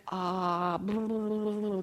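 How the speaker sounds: mock dismayed mumbling; blah-blah away the unwelcome word; dismayed babble. Imagining Peter's reaction to the prophecy, dismayed babble. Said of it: "imagine Peter being like".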